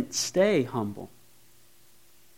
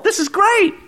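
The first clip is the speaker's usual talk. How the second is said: thrilled; 12 semitones higher, 6 dB louder than usual. Acted. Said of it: "I know what Saul's thinking".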